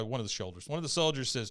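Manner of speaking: ordinary talk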